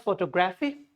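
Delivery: photography as photo-GRAFFY; photography with full O's and "gra-fee"; wrong PHOTOGRAPHY. Shown as a demonstration. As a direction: split photography into photo plus graphy. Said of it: "People say photography".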